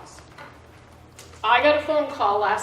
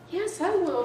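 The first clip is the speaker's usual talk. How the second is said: higher, eager agreement; daughter's mom saying yes, higher; mother's voice; 9 semitones higher than usual. Retold or imitated. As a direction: mom agreeing brightly, voice lifted. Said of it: "she said".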